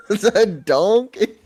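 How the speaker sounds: slow words through real laughter; cracking up mid-sentence; laughing. Real, not acted. Laughing at the Shrek impression.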